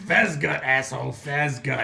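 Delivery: mock-offended shout; indignant correction. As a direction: snap your own name back at the fool who botched it. Boss corrects his mangled name.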